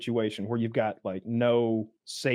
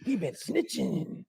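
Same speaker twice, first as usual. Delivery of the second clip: slow cool street drawl; 5 semitones higher, 5 dB quieter, slower than usual. Retold or imitated.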